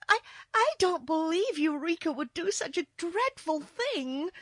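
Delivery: high, loud, stammering protest; distressed girl. A narrator's reading. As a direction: protest in a high, upset child's voice. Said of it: "much distressed".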